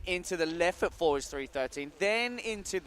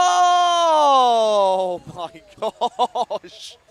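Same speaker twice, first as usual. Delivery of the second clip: long, drawn-out, high, loud cry of amazement with a laugh; amazed shout; 6 semitones higher, 14 dB louder, much slower than usual. Real, not acted. Commentator shouting in disbelief at the rail trick, amazed. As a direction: let the oh soar in disbelief.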